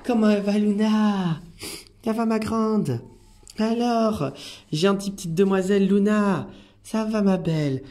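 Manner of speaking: high, singsong greeting to a beloved animal; pet-greeting coo. Real, not acted. Carer greeting young Luna at the window, high doting coo.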